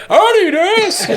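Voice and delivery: put-on high, slow, creaky old-timer; high old voice from the back. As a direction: draw it out like an eager old man. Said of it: "There's a voice from the back".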